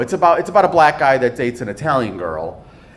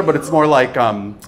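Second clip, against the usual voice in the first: ordinary talk, nothing marked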